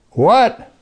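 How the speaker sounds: high, loud startled what; mock surprise. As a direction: act shocked at the command.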